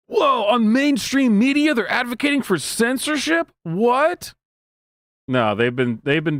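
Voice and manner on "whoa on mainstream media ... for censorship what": much higher, slow, hammed-up astonishment; mock shock. Host mocking people shocked by censorship talk, hammed-up high shock. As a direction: play fake wide-eyed disbelief.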